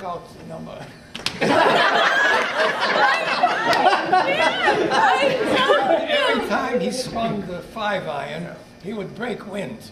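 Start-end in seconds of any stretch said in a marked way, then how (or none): 1.4-8.5 giggling through the words; cracking up mid-sentence; laughing while telling. Real, not acted. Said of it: "There I go again".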